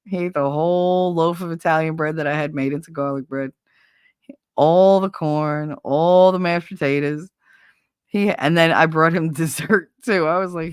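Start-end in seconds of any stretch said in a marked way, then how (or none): 4.6-7.3 slow, louder stress on all; emphatic list. Real, not acted. Listing everything friend ate, slow and emphatic. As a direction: lean on each all.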